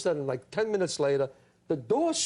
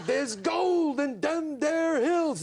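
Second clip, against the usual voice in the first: slow, much higher hollered line; big shout; 9 semitones higher, much slower than usual. Retold or imitated. Actor giving the line bigger for the casting director, slow shout. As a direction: holler it out slow like a hammy prospector. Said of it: "So I'm going".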